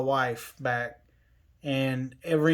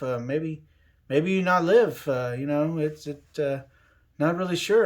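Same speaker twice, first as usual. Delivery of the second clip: mock surgeon; 2 semitones higher than usual. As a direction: play a doctor who won't commit, stammering maybes. Surgeon's vague answer retold.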